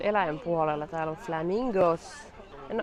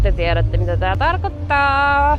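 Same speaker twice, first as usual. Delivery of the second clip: louder, emphatic aside to viewers; loud knowing exclaim; 6 semitones higher, 10 dB louder than usual. Real, not acted. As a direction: hit it hard like an inside joke. (kovaääninen tietävä huudahdus)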